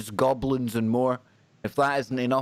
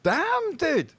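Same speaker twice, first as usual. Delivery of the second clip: very high-pitched astonishment; amazed exclamation. Real, not acted. Reacting to the voice change.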